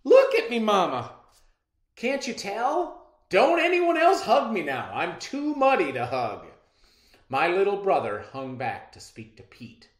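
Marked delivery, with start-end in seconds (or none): 0.0-6.5 high, loud, animated; exclaiming protest. Acted. Muddy father to his mama after the storm, loud protest. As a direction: warn everyone off in a big raised voice.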